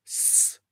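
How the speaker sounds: "s" as a drawn-out hiss alone; isolated sibilant "s"; pure s sound. Shown as a demonstration. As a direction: sustain "s" with no t before it. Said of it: "So it's not s".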